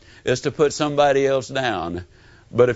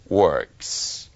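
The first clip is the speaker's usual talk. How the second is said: ordinary talk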